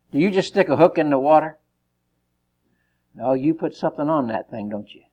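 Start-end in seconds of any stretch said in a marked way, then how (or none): none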